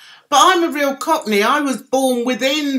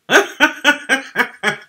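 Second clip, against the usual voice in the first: rapid run of chuckles; amused, staccato laughter. Real, not acted. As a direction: laugh in a quick string of ha-has. Amused by viewer's comment.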